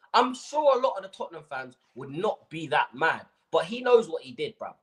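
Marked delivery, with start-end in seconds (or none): none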